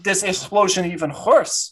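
put-on heavy Russian-accented doctor; thick Eastern European accent, slightly congested. Doctor sniffing for burnt hair, in a Russian accent. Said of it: "very Russian man".

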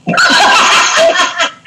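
high burst of laughter. Real, not acted. Laughing at stinky joke.

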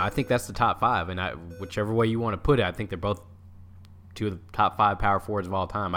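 ordinary talk, nothing marked

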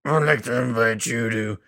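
low rough put-on rasp; gravelly voice. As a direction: croak it out like a sick friend. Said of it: "I'll moderate the entire podcast like this".

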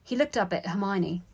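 usual speaking voice

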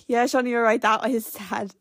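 ordinary delivery

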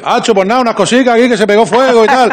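put-on a Murcian talking to a TV crew, overdoing the accent; mock Murcian accent. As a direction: play the local yokel for the TV camera. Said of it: "cuando te pasa una cámara de televisión española".

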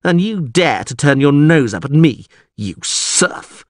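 high, loud indignant tirade; furious yell. A narrator's reading. Lord berates the scheming witcher and orders him gone, furious. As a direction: rant at them with haughty outrage. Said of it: "Ostrit yelled furiously".

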